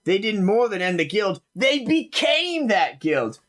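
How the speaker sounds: eager village gossip; gossiping farmhand. Acted. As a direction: farmhand gossiping, hint of excitement. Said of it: "one's like".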